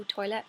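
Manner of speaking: toilet with the final T dropped. Shown as a demonstration. Showing her everyday Coleraine way, toilet without the T. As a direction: swallow the T at the end of toilet. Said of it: "I probably wouldn't".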